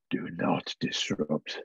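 low, slow, drawn-out sinister command; a deep voice intoning with a long pause; voice inside the head. Acted. Game master voices the warning in a player's head, low and slow. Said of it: "this voice inside your head".

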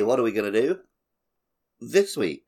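ordinary talk, nothing marked

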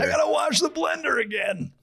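high, strained mock yell; hammed-up bartender complaint in a squeezed high voice; mock exasperated whine. Playing a bartender grumbling over the blender, high mock outrage.

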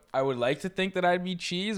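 ordinary delivery

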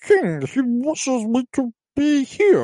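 high, mock-frail old councillor voice; imitating an old adviser clinging to his seat; whiny old man. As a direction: plead in a thin old man's whine. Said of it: "And he's like".

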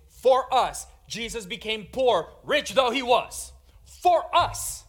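"For us" punched high and loud; stress on "for us". Shown as a demonstration. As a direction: proclaim it, hammering "For us". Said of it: "the emphasis falls on".

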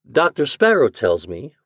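ordinary delivery, nothing marked

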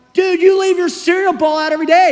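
high-pitched, exasperated outburst; fired-up mad. Retold or imitated. Student finally confronts messy roommate over dishes, fired-up mad. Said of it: "and I'm mad. I'm kind of fired up".

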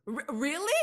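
high, disbelieving question; real surprise, voice jumping up; surprised. Real, not acted. Surprised by a compliment.

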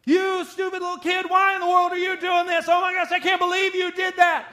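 put-on high, loud yelling at a kid. Parent reacting to a kid's bad behavior, yelling out of control. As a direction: explode at the child in a high-pitched shout. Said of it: "a lot of raised voices in the home".

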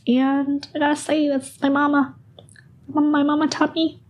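proud but choked up about mama; emotional voice. Real, not acted. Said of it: "My voice is so dumb right now".

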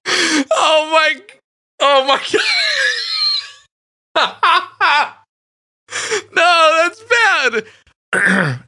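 high, teary laughter; cry-laughing. Real, not acted. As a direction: barely get the words out laughing.

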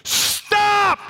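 loud, very high emergency yell; full-force shout of alarm; screamed warning. Retold or imitated. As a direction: scream it to halt a driver. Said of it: "what I did is I went".

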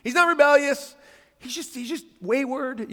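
lightly acted excusing of a son; imitating David's insistence. David excusing his son to everybody, mildly pleading. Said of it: "David's, like, trying to let everybody know".

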